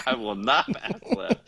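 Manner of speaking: giggly put-on defiance; laughing. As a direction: crack up while playing stubborn. Joins the joke, laughing.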